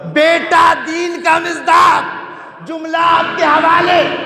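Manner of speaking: high, loud impassioned preaching; preaching at full force. Real, not acted. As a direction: build it to a ringing climax. Impassioned climax of the majlis. (پرجوش بلند آواز میں خطابت)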